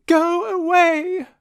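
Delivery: high, loud tearful shout; crying out. Acted. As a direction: push them away with a cry. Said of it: "cried Lily".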